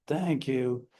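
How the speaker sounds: low, soft, grudging thank you. Shown as a demonstration. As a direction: mumble it without meaning it. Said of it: "but you said".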